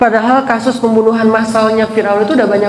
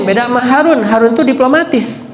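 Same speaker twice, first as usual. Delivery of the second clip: usual speaking voice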